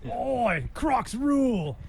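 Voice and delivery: high-pitched, drawn-out Aussie caricature; Australian accent. Pretend. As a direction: ham up an Aussie cheer. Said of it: "Can you do an Australian accent".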